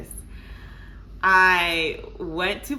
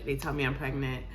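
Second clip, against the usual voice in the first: ordinary talk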